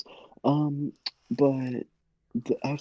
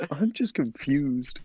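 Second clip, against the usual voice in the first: ordinary delivery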